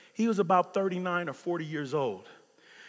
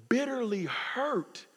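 normal talking voice

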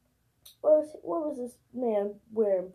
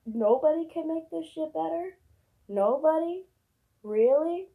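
exasperated rant; 5 semitones higher, 5 dB louder than usual. Real, not acted. Ranting at graduates.

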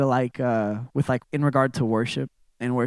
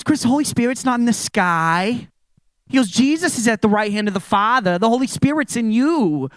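retelling the pastor's excited correction, pitched up; excited friend; 7 semitones higher, 3 dB louder than usual. Retold or imitated. Friend excitedly corrects Chris about the Holy Spirit, raised voice. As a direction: let the friend's excitement lift the voice higher. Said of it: "He gets really excited".

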